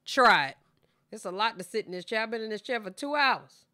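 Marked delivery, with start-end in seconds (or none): 0.1-0.6 loud laughing challenge; loud, amused dare. Real, not acted. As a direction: burst out laughing and throw down the dare. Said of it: "dares them to try it".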